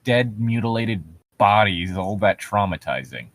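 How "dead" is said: ordinary talk, nothing marked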